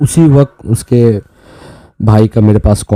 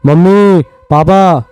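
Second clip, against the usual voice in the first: high-pitched frightened calling out; retold terrified scream; 10 semitones higher, much slower than usual. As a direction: imitate a boy waking up screaming for his parents. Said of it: "अचानक उठ के चिल्लाने लगा".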